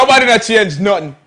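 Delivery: Jamaican patois, laughing; Jamaican accent. Shown as a demonstration. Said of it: "Can you say that in a Jamaican accent?".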